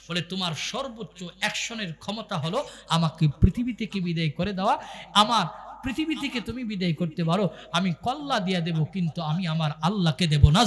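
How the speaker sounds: low, hushed story narration; sermon storytelling. A narrator's reading. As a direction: draw them into the story.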